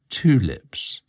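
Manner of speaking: ordinary delivery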